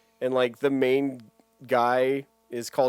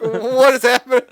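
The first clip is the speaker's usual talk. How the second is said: high, loud mock panic; loud squeaky disbelief; mock bewildered; 12 semitones higher, 13 dB louder than usual. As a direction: yelp it in cartoon alarm. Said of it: "It was like".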